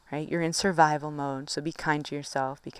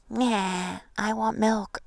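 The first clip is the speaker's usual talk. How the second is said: lightly voiced whiny toddler, a bit higher; mock angry toddler; 4 semitones higher, much slower than usual. Her anxious side as a toddler, a hint of whine. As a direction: play the anxiety as a little toddler demanding milk. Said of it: "like a angry toddler that's just like".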